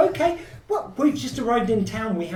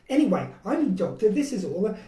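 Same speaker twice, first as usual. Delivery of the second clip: normal talking voice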